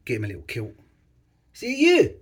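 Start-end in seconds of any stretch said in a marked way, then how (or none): 1.5-2.2 high, loud mock-Scottish yelp; Scottish lingo. Vlogger plays with child in kilt, loud mock Scottish. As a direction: squeal it in a put-on Scottish accent. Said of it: "I learned some Scottish lingo".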